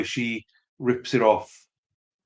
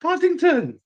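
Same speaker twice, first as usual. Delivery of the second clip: excited; 3 dB louder, slower than usual. Real, not acted. Calling the toy's name to child.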